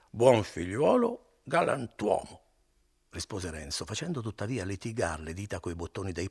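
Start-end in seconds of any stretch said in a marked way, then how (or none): 0.1-2.4 higher, louder, slow sing-song repetition; drunken echo. Acted. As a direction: parrot his words back, drunk and pleased. Drunk Renzo echoes innkeeper's words.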